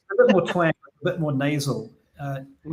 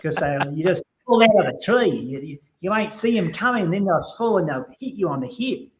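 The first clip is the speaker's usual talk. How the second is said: a Kiwi putting on a broad Aussie voice; Australian accent; 4 semitones higher than usual. Pretend.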